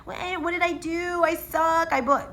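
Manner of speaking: high, whiny put-on voice; mock self-pity. Nurse mocking her own freak-out, high self-pitying whine. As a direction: mimic your own panicky inner whining. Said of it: "I was all up in my head".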